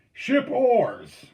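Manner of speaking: high, loud boat command; commanding shout. A narrator's reading. Steersman orders the paddlers to stop, commanding shout. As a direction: call it out across the crew. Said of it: "Charbonneau commanded".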